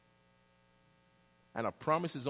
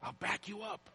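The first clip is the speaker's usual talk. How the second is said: soft but high-pitched promise; hushed repeat; 8 semitones higher, 9 dB quieter than usual. Acted. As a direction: drop to a hushed, high voice for effect. Repeats the proclaimed promise softly.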